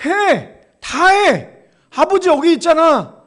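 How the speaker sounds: loud, high proclamation of the father's promise; preacher booming the father's promise; ringing shout. Acted. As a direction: shout it out to the whole church. Preacher proclaims God's words.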